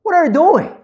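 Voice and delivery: high, loud sudden yell; angry dad shout at the toys on the floor; shouting at daughter. Retold or imitated. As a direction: snap at a messy child. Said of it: "I shouted".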